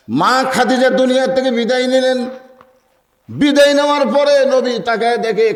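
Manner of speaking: high, sustained preacher's cadence; sermon storytelling. A narrator's reading. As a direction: lift the story into a ringing chant. Chanted sermon narration at high pitch. (উঁচু সুরে ওয়াজের বয়ান)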